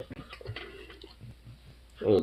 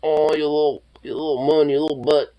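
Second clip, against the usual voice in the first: pet voice; 5 semitones higher than usual. Real, not acted. Cooing at pet.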